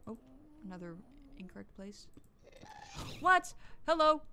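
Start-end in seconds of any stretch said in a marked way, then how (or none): 3.2-4.2 loud, high shout of alarm; startled yelp. Real, not acted. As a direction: yelp it as if suddenly attacked. Suddenly shot at in game.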